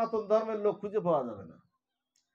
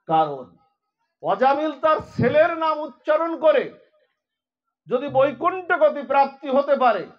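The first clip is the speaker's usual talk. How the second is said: higher, louder preaching appeal; exhorting sermon; 4 semitones higher, 6 dB louder than usual. Acted. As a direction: urge the listeners with passion. Exhorting devotees to chant Hari. (আবেগভরা আহ্বান)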